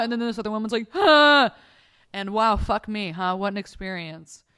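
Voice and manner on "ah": loud, high answering moan; imitated copycat wail; mimicked moan. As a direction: echo the mock moan loudly. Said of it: "and then she's moaning too".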